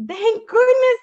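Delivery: high, loud storybook relief; relieved sigh. A narrator's reading. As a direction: voice a big "at last" relief. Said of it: "said Woodchuck with a sigh".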